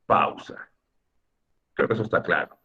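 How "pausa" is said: PAU-sa, the first syllable stressed, falling away softly. Shown as a demonstration. Teacher gives the class the answer, "pausa" stressed on PAU. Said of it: "La palabra es".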